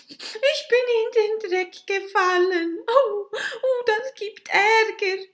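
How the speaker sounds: put-on very high, loud child's voice, sobbing; a girl tells the witches of her ruined dress, sobbing; whimpering little girl. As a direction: wail like a muddy, crying little girl. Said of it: "wimmerte das Kind".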